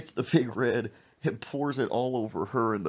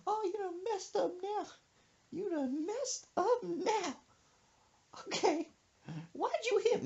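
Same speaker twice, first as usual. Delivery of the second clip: wailing hurt mama; 4 dB quieter than usual. Retold or imitated. Mother hit in the stomach, high mock-pained wail. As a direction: wail it as if winded by a blow. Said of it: "she's like".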